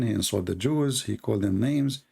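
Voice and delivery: usual speaking voice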